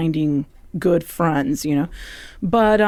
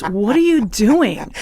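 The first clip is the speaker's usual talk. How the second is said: loud, drawn-out mock scolding; exasperated teacher; 4 semitones higher, 5 dB louder, slower than usual. Acting teacher stopping a student mid-scene, exasperated and loud. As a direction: stretch it out in raised-voice disbelief.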